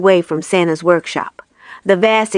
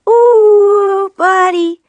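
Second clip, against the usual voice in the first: much higher, louder squeaky snowman call; 12 semitones higher, 5 dB louder, much slower than usual. A narrator's reading. Jim the snowman greeting Buddy, high loud call. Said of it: "Jim's voice was barely a whisper".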